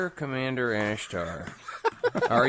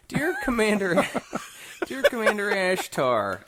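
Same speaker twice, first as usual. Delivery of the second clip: laughing through a mock letter; 8 semitones higher, faster than usual. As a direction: giggle while dictating.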